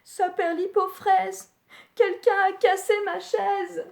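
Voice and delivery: a small voice close to tears; sad little bear. Acted. Little Bear mourning his broken chair, high and whining. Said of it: "attristé".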